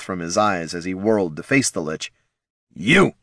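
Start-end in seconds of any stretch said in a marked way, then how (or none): 2.7-3.1 snarled. A narrator's reading. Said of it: "he snarled".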